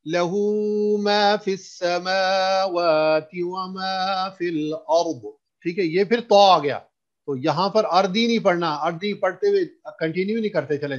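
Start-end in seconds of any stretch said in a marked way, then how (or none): 0.1-5.3 slow melodic chant with long vowels; Quran recitation. Shown as a demonstration. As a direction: stretch each vowel in measured recitation. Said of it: "मैं फिर पढ़ता हूं उसको".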